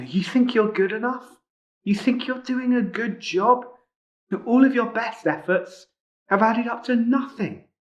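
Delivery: high, sneering schoolyard taunt; mocking taunt. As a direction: jeer at him like a playground bully. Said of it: "they just start mocking him".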